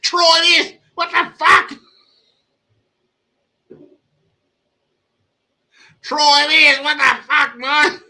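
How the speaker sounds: hammed-up Australian accent; mock Australian accent. Echoing the angry men from the clip in an exaggerated Australian accent. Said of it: "imitating the men in the clip".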